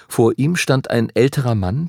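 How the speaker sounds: plain reading voice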